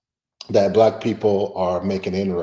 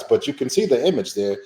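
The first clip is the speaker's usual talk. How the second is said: ordinary delivery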